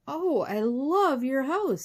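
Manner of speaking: slow, high, drawn-out delight; gushing. A narrator's reading. Cheyenne to little man about his house, gushing. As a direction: gush with loud, stretched admiration.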